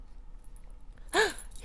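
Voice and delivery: sharp high breathy gasp; high unvoiced gasp. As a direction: catch your breath in surprise. Sudden gasp in roleplay.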